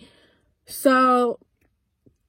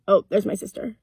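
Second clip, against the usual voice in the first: ordinary delivery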